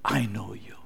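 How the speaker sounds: breathy hushed whisper of God. Acted. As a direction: whisper it like a secret promise. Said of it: "But God says".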